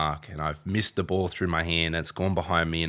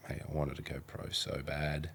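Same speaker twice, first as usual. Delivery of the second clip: crying; 2 semitones lower, 10 dB quieter than usual. Retold or imitated. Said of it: "I remember being in there crying".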